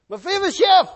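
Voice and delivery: high, very loud shout of a name; calling out. Acted. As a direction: shout to someone far down the road. Calling out to Mephibosheth.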